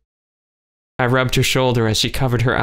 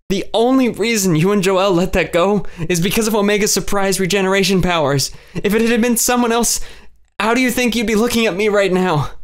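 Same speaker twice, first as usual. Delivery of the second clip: much higher, strained, pleading; tearful anger at herself; anguished challenge; 9 semitones higher than usual. A narrator's reading. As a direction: push the question up in pain.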